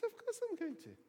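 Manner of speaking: high-pitched, acted protest; indignant assurance. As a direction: play Peter, put out at being asked. Peter protests his love to Jesus.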